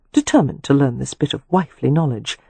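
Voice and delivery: ordinary delivery, nothing marked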